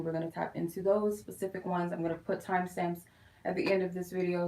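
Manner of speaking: ordinary talk, nothing marked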